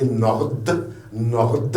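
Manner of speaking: "Nord" closed on an audible final consonant; final D sounded. Shown as a demonstration. Teacher pointing to the last letter, D sounded. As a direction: release the D at the end of "Nord". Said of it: "Il y a un D à la fin".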